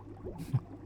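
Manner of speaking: quiet little huff of laughter; brief, private amusement; soft villain chuckle. Acted. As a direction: chuckle under your breath. Villain amused mid monologue.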